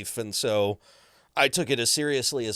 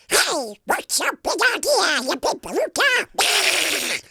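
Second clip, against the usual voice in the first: high, clearly enunciated duck voice; crisp, slow cartoon duck squawk; Donald Duck voice; 8 dB louder, slower than usual. Shown as a demonstration. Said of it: "I can do, you know, different versions of the character".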